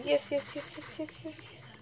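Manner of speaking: yes yes fading down to a whisper; fading yeses. Real, not acted. Gamer chanting yes, fading to a whisper.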